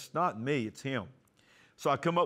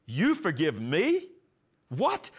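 slow, louder, rising on What in shock; stunned disbelief; 4 semitones higher, 4 dB louder, slower than usual. Retold or imitated. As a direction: replay the jolt of hearing it. Stunned at father's reply.

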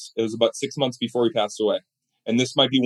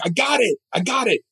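much higher, bursting with good news; excited self-quote; 10 semitones higher than usual. Retold or imitated. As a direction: blurt it out like you just won. Excitedly reporting a success to neighbor.

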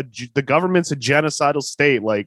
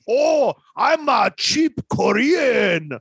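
high, slow, broken mock Korean shopkeeper; exaggerated fake Korean accent, pitched up; 7 semitones higher, slower than usual. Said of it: "it's so gross".

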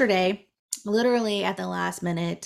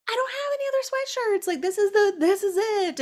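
very high mock whine at her family; whiny self; 13 semitones higher than usual. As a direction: play up a childish protest. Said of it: "And I'm like".

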